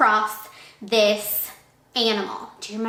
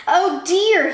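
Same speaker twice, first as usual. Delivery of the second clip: high, loud storybook fright; acted alarm; 6 semitones higher, 6 dB louder than usual. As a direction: exclaim it as a startled animal. Snake frightened by the Gruffalo.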